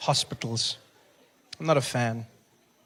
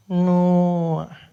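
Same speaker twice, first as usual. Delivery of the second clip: loud, higher, drawn-out echo; receptionist echoing; 5 semitones higher, 8 dB louder than usual. Acted. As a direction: turn the name over slowly, louder. Receptionist echoes the name.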